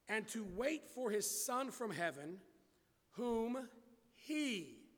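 slow, emphatic phrases with long pauses; slow reading. Real, not acted. Preacher reading on for the congregation, slowly. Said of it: "I'm gonna read it slowly".

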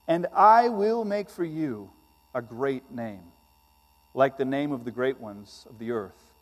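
solemn, deliberate public reading of scripture. Acted. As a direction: read God's words weightily to the congregation. Pastor reads scripture aloud.

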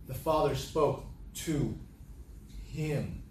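"to" and "him" each set apart by pauses; changed emphasis. Shown as a demonstration. Preacher stressing whom the Father spoke to, word by word. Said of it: "Let me change the emphasis".

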